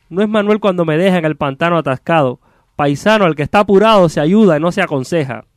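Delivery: high-pitched, declaimed cursing in verse; theatrical exasperated ox-driver; irritated carter. Acted. Stuck carter cursing whoever called him, exasperated.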